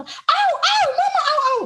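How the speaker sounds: high, loud fake yelps of pain; mock kid's cry of pain. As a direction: son pretends mama hurts him on the bus, loud high yelping. Son fake crying out on bus.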